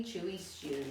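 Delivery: ordinary delivery, nothing marked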